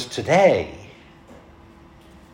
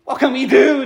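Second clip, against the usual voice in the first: crowd's anguished cry; 10 semitones higher, 3 dB louder than usual. Retold or imitated. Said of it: "they said".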